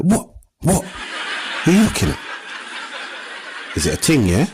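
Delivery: hushed mock road-man challenge; squaring up to four-year-olds under breath; mock playground threat. Dad squaring up to preschoolers, hushed mock menace. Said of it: "I'm like".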